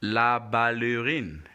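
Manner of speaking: "la ballerine" slowly, ending in a long "reen"; slow, drawn-out "la ballerine", la-ba-luh-reen; French model pronunciation. Shown as a demonstration.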